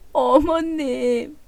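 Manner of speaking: soft sob, then a slow broken name; daughter-in-law sobbing to her mother-in-law; sobbing call. Acted. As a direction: cry it out quietly.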